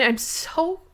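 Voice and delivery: breaking into a high, tearful whisper; whispered on the edge of crying; choked-up whisper. Real, not acted. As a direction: voice giving way as tears come. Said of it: "I'm gonna cry so hard".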